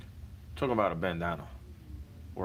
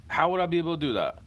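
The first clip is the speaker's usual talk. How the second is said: loud, high exasperated demand; exasperated shout; 8 semitones higher, 7 dB louder, faster than usual. Real, not acted. Complaining he can't record songs here, loud and exasperated. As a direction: hurl the question out, fed up. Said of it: "exasperated shout".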